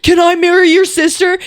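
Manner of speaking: high, loud, smitten plea; hint of a smitten suitor; eager little Italian guy. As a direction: beg like a lovestruck little guy. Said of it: "little Italian guy is like".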